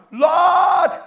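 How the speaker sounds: high, sustained shout to God. Shown as a demonstration. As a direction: call out to heaven at full volume. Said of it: "I'm shouting like an African man".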